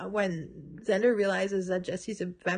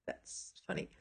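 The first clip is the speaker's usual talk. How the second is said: normal talking voice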